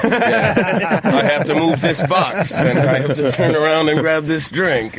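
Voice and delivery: high, slow, half-laughing goofball voice; squeaky high voice. Pretend. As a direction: play a dopey guard who can't be bothered. Acting out the lazy guard.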